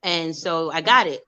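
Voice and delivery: usual speaking voice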